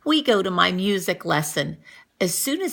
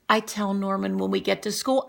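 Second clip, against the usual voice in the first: usual speaking voice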